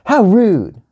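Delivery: sharp, high-pitched outburst; indignant yell. A narrator's reading. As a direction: yell it like an offended kid. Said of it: "she yelled".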